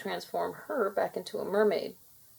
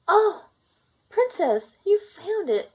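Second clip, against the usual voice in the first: much higher and louder, thrilled; overjoyed at finding the necklace; excited; 13 semitones higher, 7 dB louder, slower than usual. A narrator's reading. Callista spots the lost necklace.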